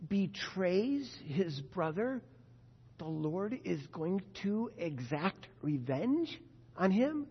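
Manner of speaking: drawn out, slowed right down in disbelief; slow incredulous question. Real, not acted. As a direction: spell out the absurd idea slowly.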